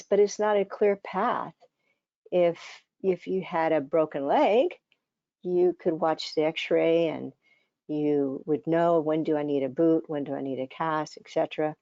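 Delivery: normal talking voice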